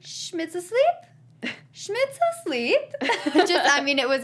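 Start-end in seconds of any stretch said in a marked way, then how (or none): 0.0-1.5 "Schmidt's asleep" drawn out slowly, high rising end; imitating Nick's odd emphasis. Imitating a terrible liar's intonation, odd stress on "asleep". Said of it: "the emphasis on all the different syllables". 1.7-2.8 "Schmidt's asleep" pitched very high, slow, questioning; imitating Nick's odd emphasis. Imitating a terrible liar's intonation, higher and louder, near laughing. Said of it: "the emphasis on all the different syllables".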